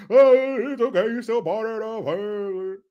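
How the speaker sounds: mock the politician's folksy crowd voice; Barry Obama. Host mimics politician soothing the crowd, mocking impression. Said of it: "he wouldn't talk like that".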